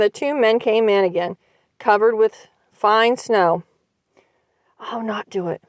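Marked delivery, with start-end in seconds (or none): 4.8-5.6 soft, trembling near-whisper; frightened, softer murmur. A narrator's reading. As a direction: hush it so the men won't hear. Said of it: "whispered Mary, trembling".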